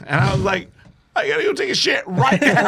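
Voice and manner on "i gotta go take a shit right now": high, urgent mock-scared plea; mock panicked urgency. Scared teller to the dude who dropped him, mock panic. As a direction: blurt it in comic panic.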